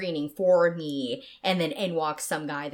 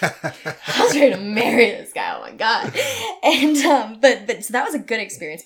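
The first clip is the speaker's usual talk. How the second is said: loud laughing gush; 5 semitones higher, 11 dB louder than usual. Real, not acted. Telling her cohost about the hot guy, laughing loud. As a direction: gush through the giggles.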